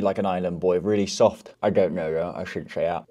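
chewing on a stick; talking around a chew stick; stick in mouth. Real, not acted. Seen 2: Wooden stick held between teeth, chewed while talking, removed by 6.0.

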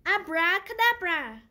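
loud, ringing magic word; shouted spell. A narrator's reading. Witch Winnie shouting her spell at the broomstick. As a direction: cast the spell with a big shout. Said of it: "and shouted".

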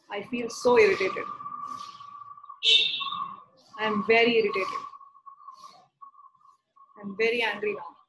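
measured statements, spaced out for her to repeat; guided tapping affirmation. Acted. Therapist leading Neha through tapping statements, steady guiding voice. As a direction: lead the setup phrases in a steady guiding voice. Leads EFT setup statements for repetition.

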